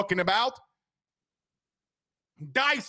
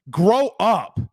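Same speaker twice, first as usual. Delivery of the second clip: loud exasperated scold, higher; grow up; 4 semitones higher, 6 dB louder than usual. Real, not acted. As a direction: snap it at the fans, fed up. Annoyed host scolding fans.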